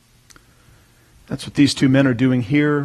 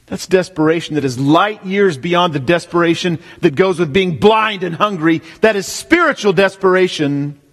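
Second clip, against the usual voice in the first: preaching; 4 semitones higher than usual. Acted. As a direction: drive it home from the pulpit. Preacher building again.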